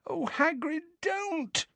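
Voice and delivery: very high, soft pleading moan; frightened moan. Acted. Hermione begging Hagrid not to poke the giant, moaning. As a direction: plead softly, scared stiff. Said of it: "moaned Hermione".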